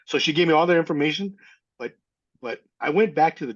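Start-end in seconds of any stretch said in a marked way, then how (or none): none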